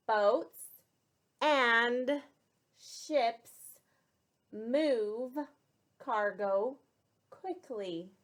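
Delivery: slow, one word at a time with pauses; word-by-word dictation. Shown as a demonstration. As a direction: let each word land for the children to echo. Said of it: "Repeat after me".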